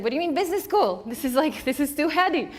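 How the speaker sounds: high, loud panicked protest; freaking out. Retold or imitated. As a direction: blurt it in alarmed disbelief. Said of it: "my first reaction was freaking out".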